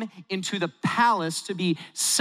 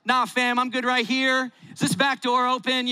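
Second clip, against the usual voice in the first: higher, playful nervous brush-off; nervous brother; 6 semitones higher than usual. Pretend. Imagined brother dodging Joseph, higher and jokey. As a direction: back away from Joseph, joking. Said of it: "I'd be like".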